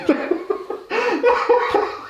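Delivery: very high, squeaky fit of laughing; real high-pitched cackling laugh; helpless laughter. Real, not acted. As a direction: lose it completely at the joke. Laughs at own retold joke.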